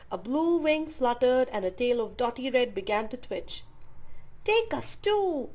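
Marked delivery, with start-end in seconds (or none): 4.4-5.5 much higher, slow, pleading cry; pretty owl begging to join the flight; eager cry. A narrator's reading. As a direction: beg to come along. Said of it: "cried the pretty owl".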